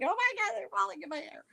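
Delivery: high, panicked squeal; freaking out. Retold or imitated. Woman freaking out while knocking spiders down. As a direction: shriek as spiders fall in your hair. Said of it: "freaking out like".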